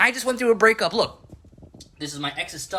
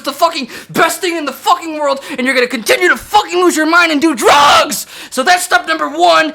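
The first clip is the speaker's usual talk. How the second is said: screaming it out, louder and higher; ranting shout; 9 semitones higher, 8 dB louder than usual. Acted. Reviewer rants at Olivia Rodrigo, yelling. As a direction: blow up into a full mock-furious yell.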